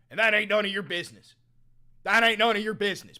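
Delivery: bellowing it at chat; shouted. Acted. Angrily yelling at chat to mind their business. Repeated angry outburst at chat.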